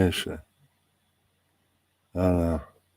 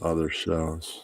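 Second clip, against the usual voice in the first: deep villain voice; 3 semitones lower, 9 dB quieter, slower than usual. Real, not acted. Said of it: "like a Marvel villain".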